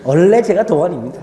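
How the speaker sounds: loud joking boast. Real, not acted. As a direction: burst out proudly, playing it for laughs. Jokingly boasting of youthful looks.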